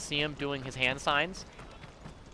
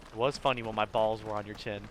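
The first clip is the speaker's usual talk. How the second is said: deadpan; 6 semitones lower, 5 dB quieter than usual. Retold or imitated. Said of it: "This deadpan says it".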